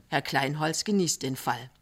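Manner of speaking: ordinary delivery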